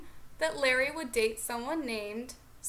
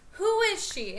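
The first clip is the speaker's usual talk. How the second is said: higher, louder angry demand; imitated yell; 8 semitones higher, 5 dB louder than usual. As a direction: shout the question like a jealous girlfriend. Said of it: "she's like".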